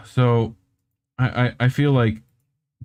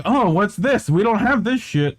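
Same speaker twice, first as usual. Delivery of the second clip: higher, puzzled cat voice; voicing curious cats; 7 semitones higher than usual. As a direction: act baffled as the hairless cats. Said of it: "they're like".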